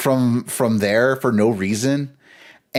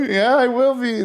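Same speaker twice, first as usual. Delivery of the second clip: high, laughing imitation of a sobbing kid; imitating a crying kid; 13 semitones higher than usual. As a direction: mimic a kid gasping through tears.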